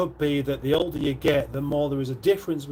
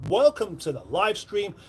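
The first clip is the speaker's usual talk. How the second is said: ordinary talk, nothing marked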